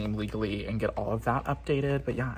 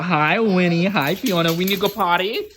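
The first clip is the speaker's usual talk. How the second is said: dog voice; 7 semitones higher, 9 dB louder than usual. Real, not acted. Greeting her dad's dogs, loud high baby talk. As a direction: coo at the dogs like babies. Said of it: "ignore my dog voice".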